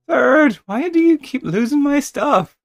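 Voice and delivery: high, loud, slow complaint; exasperated whine. Acted. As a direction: whine about lost stuff. Complaining at a character.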